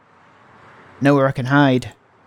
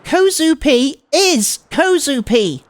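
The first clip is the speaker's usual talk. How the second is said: put-on slow, louder, huffy cutesy girl; pissed off; 10 semitones higher, 3 dB louder, much slower than usual. Transfer student annoyed at her name being wrong, slow huffy cute voice. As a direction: stamp out each word in cute annoyance. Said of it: "Oh, she's pissed off".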